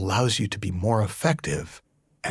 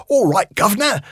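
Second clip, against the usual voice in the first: loud, high-pitched mock British accent; 12 semitones higher, 8 dB louder than usual. Drama student showing off a best British accent, loud hammy fake British. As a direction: belt out a hammy, badly faked British accent.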